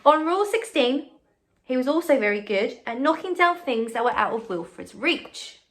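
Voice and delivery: put-on bright, lively read-aloud to children; story reading. A narrator's reading. Teacher reading a picture book to her class, bright and lively.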